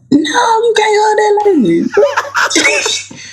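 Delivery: high, slow, laughing no; playful high-pitched protest; laughing mock refusal. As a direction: cry out the refusal through laughter. Mom refusing daughter, laughing.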